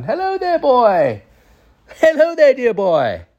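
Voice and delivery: high, theatrical greeting that falls away; genie-like grandpa. Retold or imitated. Grandpa hugging the boy to his belly, high genie voice. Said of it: "like, a fairy or, like, like the genie".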